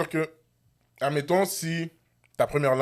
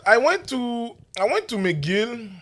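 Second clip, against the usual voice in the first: a showy put-on anglophone, louder than usual; mock anglophone; 7 semitones higher, 3 dB louder than usual. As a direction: play the pompous anglophone, stretching every word. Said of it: "Je suis anglophone".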